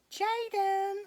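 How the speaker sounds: name sung out high; excited reveal. Real, not acted. Revealing the doll to viewers, excited and high. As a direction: announce the name with delight.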